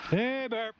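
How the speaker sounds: high, sharp call to the bear; scaring off a bear. Real, not acted. Calling out to scare bear.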